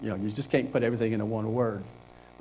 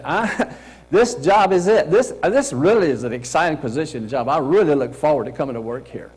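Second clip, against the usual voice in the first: genuinely excited; 2 semitones higher, 5 dB louder than usual. Shown as a demonstration. Coach showing how to tell an interviewer, excited. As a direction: sound truly thrilled to land this job. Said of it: "You gotta say".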